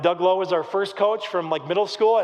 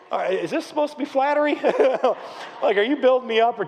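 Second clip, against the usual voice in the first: retold bafflement; 6 semitones higher, faster than usual. His baffled reaction to coach.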